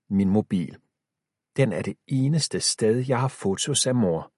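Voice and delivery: ordinary delivery, nothing marked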